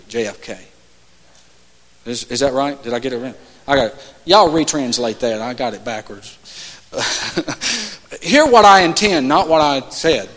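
ordinary talk

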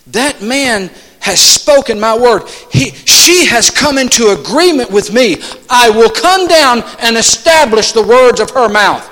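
emphatic, deliberate pulpit declaration; building, forceful preacher voicing God; God's proclamation, preached. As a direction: proclaim it with divine authority.

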